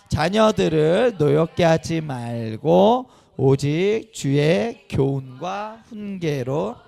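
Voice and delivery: loud, very slow, measured recitation; reading scripture aloud. Real, not acted. Pastor leading parents in reading a verse, loud slow recitation. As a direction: lead a group reading, drawing out each phrase. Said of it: "한번 읽어보겠습니다. 시작!".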